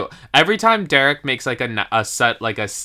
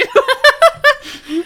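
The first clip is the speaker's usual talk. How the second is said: much higher squealing laugh; high burst of laughter; 4 dB louder than usual. Real, not acted. Laughing at her own story.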